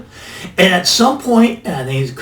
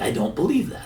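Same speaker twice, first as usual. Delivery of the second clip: a softer, quieter I don't believe that; quiet repeat; 8 dB quieter, faster than usual. Shown as a demonstration. Investor doubting the Bitcoin forecast, quiet repeat. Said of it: "Now, I don't believe that".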